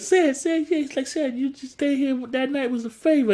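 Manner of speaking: a hint of an annoyed host; lightly voiced Steve. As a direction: press the point to Sid.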